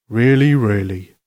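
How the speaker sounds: slow, steady Father Christmas voice; reassuring Santa. Acted. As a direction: confirm it slowly as a kindly Santa. Santa confirms it to Lucy.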